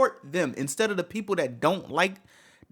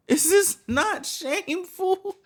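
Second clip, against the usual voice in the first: much higher, slow disbelieving repeat; incredulous outrage; 11 semitones higher, slower than usual. Real, not acted. Streamer asking fans if they feel shame, incredulous.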